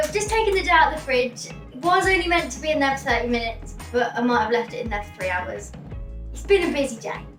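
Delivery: ordinary talk, nothing marked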